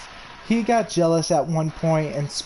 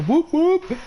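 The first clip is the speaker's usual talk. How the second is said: high, loud juggalo whoop call; excited scream; 14 semitones higher, 3 dB louder than usual. Retold or imitated. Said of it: "I couldn't help but start screaming".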